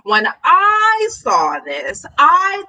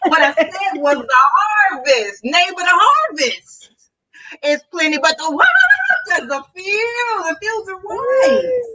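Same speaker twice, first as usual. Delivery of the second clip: fired-up mock preacher; 5 semitones higher than usual. Pretend pastor rousing the neighbors, fired-up preaching. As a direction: work the congregation up, pitch soaring. Mock sermon rising to cries.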